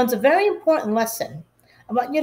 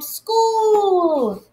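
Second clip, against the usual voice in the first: put-on high, bright child's cheer; delighted little cheer; 9 semitones higher than usual. A narrator's reading. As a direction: squeal it with delight.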